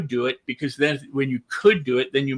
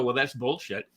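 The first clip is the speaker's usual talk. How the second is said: muttered dismissal; 4 semitones lower, 6 dB quieter than usual. Retold or imitated. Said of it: "you don't have to go".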